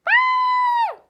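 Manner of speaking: high, loud terrified scream. A narrator's reading. Goldilocks wakes to three bears and screams loudly. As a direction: let out a piercing shriek of fright. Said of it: "And she screamed".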